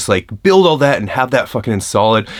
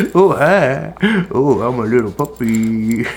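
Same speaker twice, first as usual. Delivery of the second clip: puppy voice; 3 semitones higher, slower than usual. Pretend. Baby talk for the dog.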